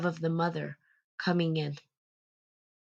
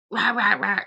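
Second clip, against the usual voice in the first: mock barking mother, louder and higher; harsh nagging mother; 4 semitones higher, 7 dB louder than usual. A mother scolding her child, mock harsh nagging. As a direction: rattle it off like a pushy mother. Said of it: "a mother approaching me like".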